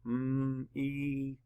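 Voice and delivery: M-E-T-er as separate sounds: mmm, ee, t, er; sounded out. Shown as a demonstration. Teacher models sounds for students, M-E-T-er drawn out. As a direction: stretch each sound of M-E-T-er, pausing between. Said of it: "Hope you did those sounds".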